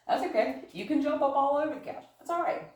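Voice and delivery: high, gentle reassurance; reassuring dog talk. Real, not acted. Trainer reassuring her dog in high dog talk. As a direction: let him know it's fine.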